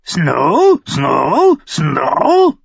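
high, loud repeated bird cries; raven squawking. A narrator's reading.

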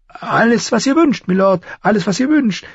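high-pitched, obliging hostess; eager innkeeper. A narrator's reading. Innkeeper promises the lord anything, high and obliging. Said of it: "versprach die Wirtin".

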